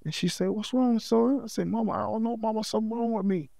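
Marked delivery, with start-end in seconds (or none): 0.5-1.4 mother's worried question. Retold or imitated. Mother voiced in story. 1.7-3.5 higher, softer, rushed plea to mama; helpless plea. Retold or imitated. Son on the jail phone confessing to his mama, higher, softer, hurried plea. Retold call to his mom.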